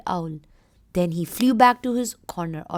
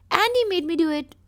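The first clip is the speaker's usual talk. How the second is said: higher, louder whiny excuse; child blaming another; 8 semitones higher, 4 dB louder than usual. Acted. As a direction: push the blame off like a caught child.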